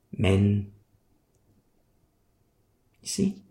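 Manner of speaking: ordinary talk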